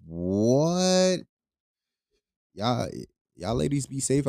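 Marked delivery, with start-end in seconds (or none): 0.1-1.2 incredulous what; stunned what; surprised. Real, not acted. Reacting to the story.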